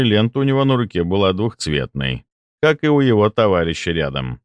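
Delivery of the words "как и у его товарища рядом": plain reading voice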